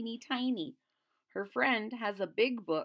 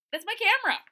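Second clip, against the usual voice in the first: whiny protest; 10 semitones higher, 9 dB louder, much faster than usual. Acted. Martin left protesting.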